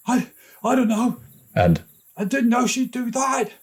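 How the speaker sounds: very high stammering; flustered. A narrator's reading. Older brother stammers while fleeing, high and flustered. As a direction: babble excuses while running. Kieran voiced.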